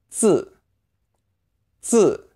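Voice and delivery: 字 with tongue forward, a tight buzzing z. Shown as a demonstration. Teacher demonstrating the 字 sound. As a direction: clench and buzz 字. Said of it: "clench your mouth ... tongue more forward".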